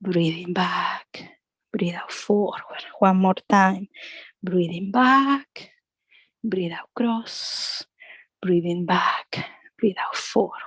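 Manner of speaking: slow, hushed breathing cues, partly whispered; guided breathing cue. Acted. Yoga teacher guiding the class's breathing, slow and hushed. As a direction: ease the class through each breath.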